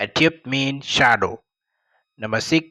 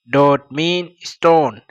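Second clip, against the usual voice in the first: slow explanation; 3 dB louder, much slower than usual. Shown as a demonstration. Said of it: "teacher explains word meaning".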